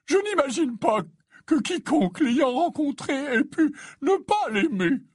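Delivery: put-on high, tearful old man's voice; high-pitched, emotional sigh of affection. A narrator's reading. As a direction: answer with eyes brimming, high and tender. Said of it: "les yeux remplis de larmes".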